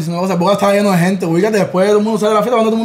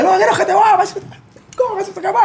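much higher, laughing mock woman; woman's voice; 12 semitones higher than usual. Storyteller mimics the woman telling him to leave, high and laughing. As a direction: mimic her shrilly with a laugh.

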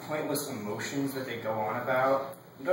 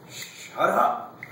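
exasperated retort; 5 semitones higher than usual. Retold or imitated. Son snapping back at nagging parents, exasperated. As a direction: burst out at the nagging, mock fed-up.

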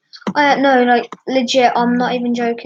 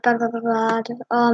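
usual speaking voice

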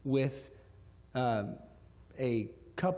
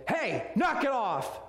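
high, drawn-out commanding yell; re-enacted stern-dad shout; 9 semitones higher, slower than usual. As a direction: bark it like a dad stopping misbehaving kids. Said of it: "time to use the dad voice".

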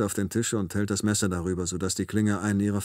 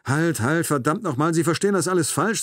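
raised, urgent plea; urgent protest; 7 semitones higher, 4 dB louder than usual. A narrator's reading. Narrator pleads as the knife presses down, urgent and alarmed. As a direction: cut in urgently to stop him.

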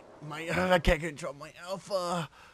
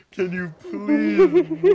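high, loud laughing plea, drawn out slowly; half-shouting through laughter to get her to move; laughing yell; 12 semitones higher, 12 dB louder, much slower than usual. Real, not acted. Girlfriend told to move, yelled high and loud through a laugh.

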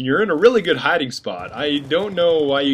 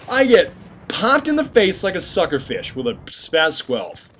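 normal talking voice

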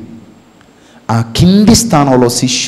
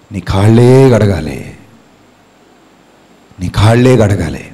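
Jesus's gentle answer; 5 semitones lower than usual. Acted.

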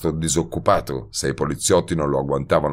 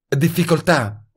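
higher female voice, worried; Betty's voice; 10 semitones higher than usual. A narrator's reading. (voce femminile più acuta, preoccupata)

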